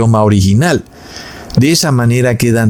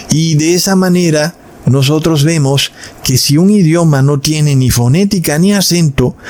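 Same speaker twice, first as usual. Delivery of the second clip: ordinary delivery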